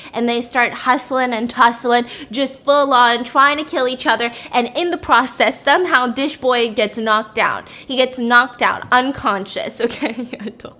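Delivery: usual speaking voice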